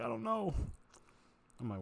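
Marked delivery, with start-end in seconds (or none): 0.0-0.5 high-pitched whiny mock sob; mock crying. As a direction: imitate a man crying, pitch way up. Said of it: "he just started crying in his hands".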